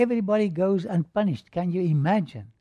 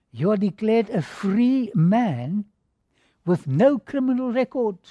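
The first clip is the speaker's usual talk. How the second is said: proclaiming verdict; 4 semitones higher, 4 dB louder than usual. Retold or imitated.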